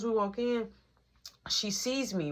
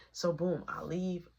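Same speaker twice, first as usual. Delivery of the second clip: ordinary talk, nothing marked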